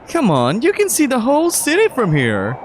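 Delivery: put-on high, upbeat young man; bright excited tour guide boyfriend; lifted pitch, cheerful guy; Mark's voice. Mark voiced again.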